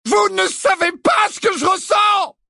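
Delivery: loud, very high furious shout; raging. A narrator's reading. Harry raging at Dumbledore, shouting. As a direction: bellow it in anguish. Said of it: "gronda Harry".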